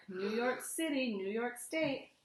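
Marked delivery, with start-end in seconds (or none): none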